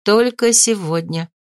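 plain reading voice

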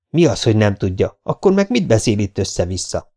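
plain reading voice

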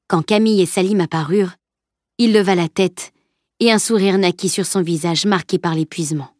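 ordinary delivery, nothing marked

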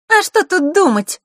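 put-on very high, loud smiling girl; Маришка. A narrator's reading. Girl steps forward unexpectedly, high smiling voice. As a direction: pipe up brightly with a smile. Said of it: "произнесла она, улыбаясь".

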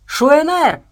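loud, high battle cry; a sudden loud shout of a word; shouted name. A narrator's reading. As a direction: yell it out across the room. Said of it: "и прокричал".

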